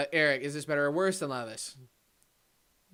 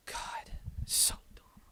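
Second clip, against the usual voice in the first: sigh, then whisper it hopelessly; soft, voiceless dismay; worn-out whisper; defeated whisper; 12 dB quieter, much slower than usual. Real, not acted. Sighing in defeat after the rant.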